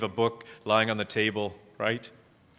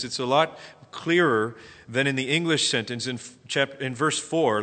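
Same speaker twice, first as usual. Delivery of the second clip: ordinary talk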